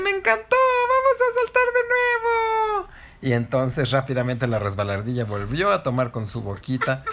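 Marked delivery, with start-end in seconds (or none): 0.0-2.9 loud, very high squeal of delight; thrilled outburst. Acted. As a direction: burst out begging for another go. Kena salta eufórica de la hojarasca. (grito agudo de entusiasmo)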